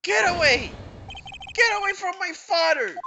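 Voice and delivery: high, loud desperate scream; boy screaming at his father's attacker. Acted. Child defending father with pistol.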